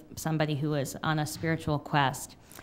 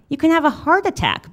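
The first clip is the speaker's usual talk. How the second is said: higher, louder worried warning; worried plea; 10 semitones higher, 13 dB louder than usual. Retold or imitated. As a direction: plead with alarm in the voice. Worried friend pleads with narrator.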